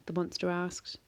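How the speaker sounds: ordinary delivery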